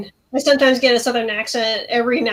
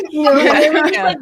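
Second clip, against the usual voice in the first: usual speaking voice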